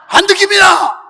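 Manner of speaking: high, loud schoolboy answer; student's shouted reply. Retold or imitated. Student answering an angry gym teacher, high shout. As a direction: yell it out like a pupil answering. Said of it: "이 학생이 이름이 안 듣기야".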